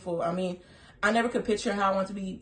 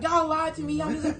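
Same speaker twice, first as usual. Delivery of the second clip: higher playful accusing; mock accusation; 9 semitones higher than usual. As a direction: accuse them in fun. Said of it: "So I was like".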